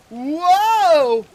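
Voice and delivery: long high whoa, very loud; shouted whoa. Acted. Player reacting to Sonic the Hedgehog moves, shouted whoa. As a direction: yell a stretched-out, soaring whoa. Reacting loudly to game action.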